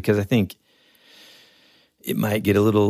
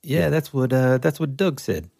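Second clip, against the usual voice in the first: slightly higher-pitched imitation of a movie character; lightly voiced rock guy; 6 semitones higher than usual. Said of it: "he's the rock guy".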